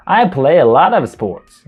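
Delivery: "I play a lot of sports" slow and loud, "a lot of" run together as "larev"; linked model sentence. Shown as a demonstration. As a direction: say "a lot of" as one linked word, slowly. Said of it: "اینجوری میگه". ("a lot of" را پیوسته و آهسته بگو)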